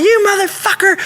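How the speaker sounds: high-pitched imitation of a yelling woman; screaming girlfriend. Girlfriend charging round the corner at the bouncer, screaming and yelling. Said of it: "screaming and yelling".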